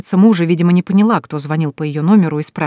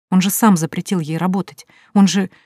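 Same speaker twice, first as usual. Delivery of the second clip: plain reading voice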